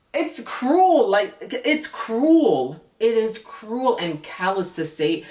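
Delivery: stretched-out indignant cruel; outraged. Real, not acted. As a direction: cry out how cruel it is. Outraged reaction to his line.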